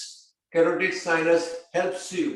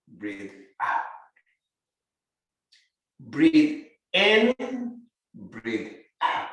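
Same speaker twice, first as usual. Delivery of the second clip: guided breathing; 2 semitones lower than usual. Acted. Yoga teacher counting breaths for the class.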